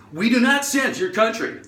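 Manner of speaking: high, exaggerated mocking repeat; mocking error message. Website taunting the foreign shopper, high mocking voice. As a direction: parrot it back in a high voice.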